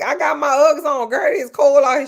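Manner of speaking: high, loud mock whine about the cold; whiny cold complaint. To her Chicago friend, a high loud whiny cold complaint. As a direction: play yourself as a bundled-up, freezing drama queen. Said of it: "I'm like".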